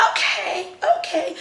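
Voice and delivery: mock-meek, very high okay; high-pitched okay. Vlogger retelling her reply to the cover girl, high-pitched. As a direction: squeak out a retold okay, way up high.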